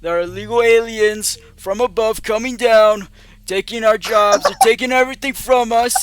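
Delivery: rally the crowd like a bragging candidate; firefighter shouting through mask. Acted. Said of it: "He goes".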